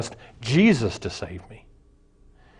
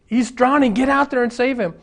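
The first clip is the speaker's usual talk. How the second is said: panicked wife yelling; 11 semitones higher than usual. Acted. Wife screaming to the lifeguard to save her drowning husband, frantic.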